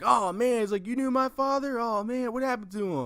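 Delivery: put-on high, excited kid hearing about his father; eager young Luke. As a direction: play the awed farm boy.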